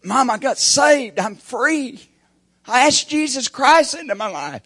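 high, loud joyful cry; joyful shout. Retold or imitated. Son shouting to his mama he got saved. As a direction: shout the news of being saved.

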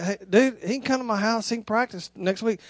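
low, hushed offer; quiet aside. Retold or imitated. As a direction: slip it discreetly to the dad. Pablo's quiet aside to father.

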